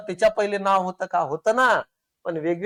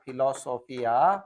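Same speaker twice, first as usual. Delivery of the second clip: slow dictation; 5 semitones lower, 3 dB quieter, slower than usual. Shown as a demonstration.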